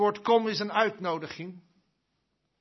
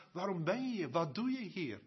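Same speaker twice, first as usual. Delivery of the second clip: quiet questioning; 9 dB quieter, faster than usual. Retold or imitated. People in Israel question him.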